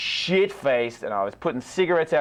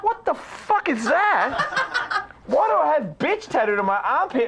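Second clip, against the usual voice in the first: very high, slow, laughing disbelief; seeing the tattoo in the mirror, laughing shock; 11 semitones higher, slower than usual. Retold or imitated. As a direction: stretch out the horror, cracking up.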